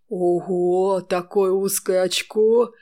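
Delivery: hoarse, smoky voice; smoker's rasp. A narrator's reading. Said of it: "сиплым прокуренным голосом".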